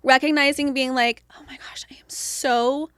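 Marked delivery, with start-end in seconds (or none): 1.3-2.9 hushed, drained whisper to herself; exhausted whisper. Retold or imitated. Overstimulated mother whispers to herself that she needs quiet. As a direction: barely voice it, worn out.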